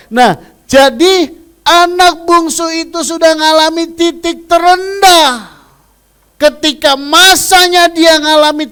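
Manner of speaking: high, loud sustained preaching; full-force preaching. Acted. Preacher to congregation about the youngest son, full force. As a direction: drive the lesson home.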